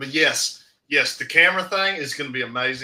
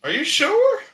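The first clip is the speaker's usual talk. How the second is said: surprised doubt; 7 dB louder, slower than usual. Real, not acted.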